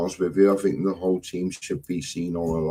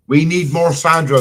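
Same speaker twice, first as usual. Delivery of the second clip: high, loud mimicry of her; mocking quote; 6 semitones higher, 9 dB louder than usual. Husband mimics wife's line to tease her, loud and high. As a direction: parrot her line back, higher and louder. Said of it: "says Jamie".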